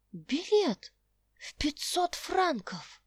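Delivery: high, slow astonished cry; amazed. Acted. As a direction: gasp it in wonder. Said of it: "воскликнула Роза изумлённо".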